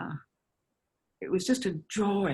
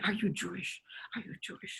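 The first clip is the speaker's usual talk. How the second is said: hushed, breathy question repeated softer; confidential whisper; 9 dB quieter, faster than usual. Retold or imitated. Actress stopping people in delis to ask, whispering. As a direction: whisper it like a secret question to a stranger.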